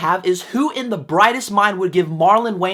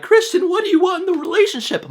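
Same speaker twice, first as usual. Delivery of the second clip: mock heroine voice; 11 semitones higher than usual. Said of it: "the girl's all like".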